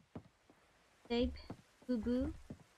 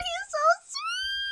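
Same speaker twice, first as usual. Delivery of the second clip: very high, drawn-out gushing squeal; 4 dB louder, slower than usual. Real, not acted. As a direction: melt into a squeaky gush. Squealing over sweet character.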